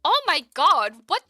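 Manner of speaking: high, loud disbelieving shout with a laugh; shocked laughing yell. Real, not acted. Streamer reacting to sudden chaos, laughing yell.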